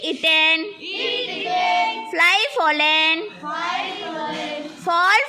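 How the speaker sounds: slow, drawn-out teacher chant; measured classroom verb recitation; repeat-after-me drill. Shown as a demonstration. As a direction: stretch each word for the class to echo. Leads word repetition drill.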